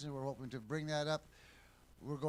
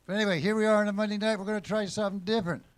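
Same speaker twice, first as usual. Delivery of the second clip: fast, louder, higher kickoff; upbeat show opener; 5 semitones higher, 8 dB louder, faster than usual. Real, not acted. Host opening Monday night show, fast and loud. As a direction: launch the show with a burst of energy.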